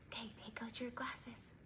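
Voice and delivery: soft unvoiced whisper. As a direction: whisper it like a secret. Whispered instruction to kids.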